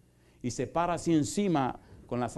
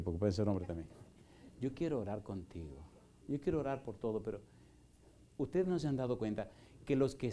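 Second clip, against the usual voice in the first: soft, low, intimate preaching; tender altar-call appeal, hushed and earnest; 4 semitones lower, 6 dB quieter than usual. Acted. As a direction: draw them in gently to come forward.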